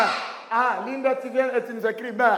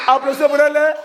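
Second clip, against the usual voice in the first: loud, chanted call for applause; rally the whole class, loudly; 2 semitones higher, 8 dB louder, faster than usual. Real, not acted. Teacher to class after a pupil's answer, calling out.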